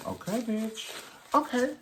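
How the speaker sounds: high, sassy okay bitch. Pretend. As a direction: toss off the okay with high sass.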